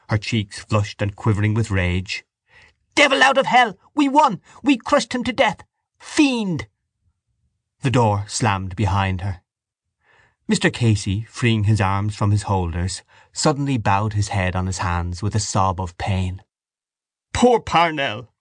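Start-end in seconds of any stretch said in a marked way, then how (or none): 2.9-6.7 very high, triumphant shrieking; enraged shout. A narrator's reading. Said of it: "shouted down the room". 17.3-18.3 slow, higher lament; loud grieving cry. A narrator's reading. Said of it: "he cried loudly".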